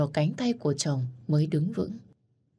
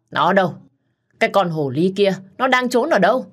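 loud, much higher yelling demand; furious shout; 4 semitones higher, 6 dB louder than usual. A narrator's reading. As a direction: hunt the girl down, raging. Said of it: "mặt hầm hầm giận dữ". (bà mẹ giận dữ quát hỏi)

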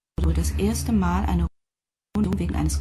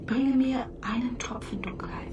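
a teasing, secretive whisper; playful whisper; 5 semitones higher, 5 dB quieter than usual. Acted. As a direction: whisper it with a hint of a laugh. Sprecherin flüstert durchgehend. (verspieltes Flüstern mit leichtem Lachen)

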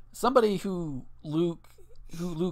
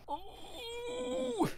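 high, soft squeal of mock pain; thin, drawn-out high whine; pained mock whimper; 10 dB quieter than usual. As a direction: whimper it as if wounded. Mock dismay at watered-down beer.

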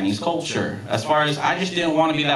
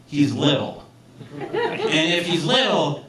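ordinary talk

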